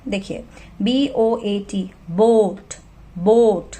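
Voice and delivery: slow spelling, बोट with a long ओ; slow teacher spelling, long ओ in बोट; long vowel demo. Shown as a demonstration. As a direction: spell each letter slowly, then बोट. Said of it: "first vowel है उसकी long sound".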